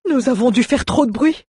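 high-pitched, agitated character voice; dwarf swearing. A narrator's reading. Dwarf fears they made too much noise, high agitated oath. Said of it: "jura Mjoln".

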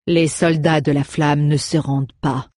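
plain reading voice

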